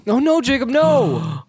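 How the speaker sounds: high, loud dramatic no; mock alarm. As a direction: cry out in hammy alarm. Mock alarm at spilled beer.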